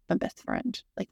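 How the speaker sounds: ordinary delivery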